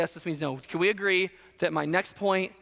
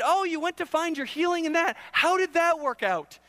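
cutting sarcastic jeer, pitched up; mean sarcasm; 8 semitones higher than usual. Acted. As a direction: rub it in with mock concern. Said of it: "God isn't just sarcastic, but he's mean".

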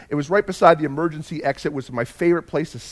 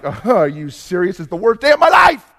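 loud, higher, sarcastic outburst; exasperated shout; 4 semitones higher, 6 dB louder than usual. Retold or imitated. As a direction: yell it, fed up and sarcastic. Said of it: "And I'm like".

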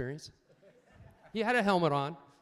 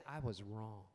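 humble admission; 7 semitones lower, 12 dB quieter than usual. Shown as a demonstration. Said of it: "humble yourself and say".

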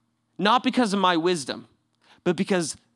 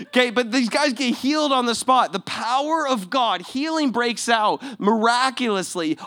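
ordinary delivery

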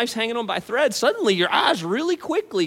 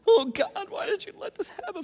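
mock anguished prayer; 10 semitones higher, 6 dB quieter than usual. Mock despairing prayer to God.

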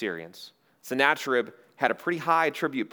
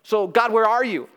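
high, loud demanding appeal; crying out to God; 9 semitones higher, 7 dB louder than usual. Acted. Besieged city asks where God is, loud pleading challenge. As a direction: call God to account, voice raised.

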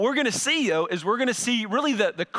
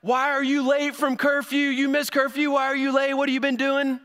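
higher, stern demanding parent; parent scolding; 7 semitones higher than usual. Retold or imitated. Parent scolding a teenager late from curfew, stern and demanding. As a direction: grill the teen like an upset dad. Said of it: "and you're like".

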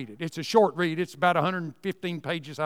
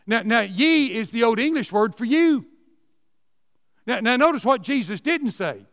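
ordinary talk, nothing marked

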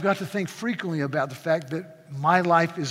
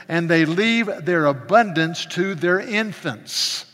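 preaching the psalm; 7 dB louder than usual. Acted. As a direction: proclaim the verses to the church. Reading psalm with fervor.

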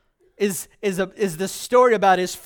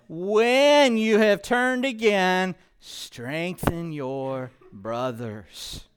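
slow, deliberate; slow scripture emphasis; much slower than usual. Acted. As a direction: let every word land slowly. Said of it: "He said".

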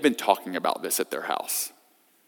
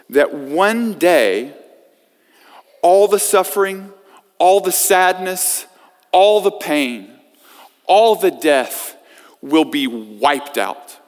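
slow and higher, pounding each all; rising preacher cadence; 5 semitones higher, 4 dB louder, slower than usual. Real, not acted. Preacher to congregation, slow rising sermon build. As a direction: build the list like a sermon climax.

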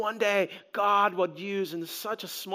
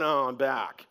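lower, softer deflated "oh, I'm back"; glum Lazarus; 8 semitones lower, 4 dB quieter than usual. Retold or imitated. Lazarus, interviewed after returning, deflated and low. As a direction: let it sag, disappointed to be back. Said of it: "Lazarus afterward going".

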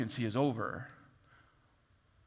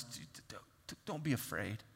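stammering softly; 5 semitones lower, 12 dB quieter than usual. Retold or imitated. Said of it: "someone's telling us".

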